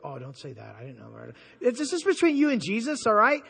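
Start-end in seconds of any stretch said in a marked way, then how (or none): 0.0-1.3 imitating a listener, very low and soft; hushed, half-hearted excuse; mock sheepish protest. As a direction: shrink into a small guilty murmur. Voicing listeners caught liking the shows.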